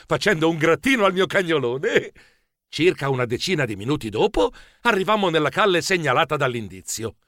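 ordinary delivery, nothing marked

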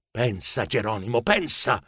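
soft, low self-talk; talking to himself. A narrator's reading. Narrator urging himself to think, hushed self-talk. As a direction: think aloud, low and under your voice. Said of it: "mi dissi".

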